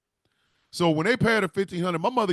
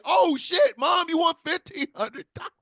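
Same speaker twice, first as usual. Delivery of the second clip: excited shout; 10 semitones higher than usual. Retold or imitated. Son shouting in joy at his mother's jackpot. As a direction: yell it out in disbelief and joy.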